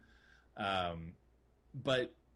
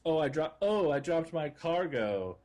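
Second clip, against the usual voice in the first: higher, louder mock oops; slow, hammed-up feigned dismay; 5 semitones higher, 4 dB louder than usual. Mission runner feigning an accident to the client, mock dismay. Acts out pilot losing cargo.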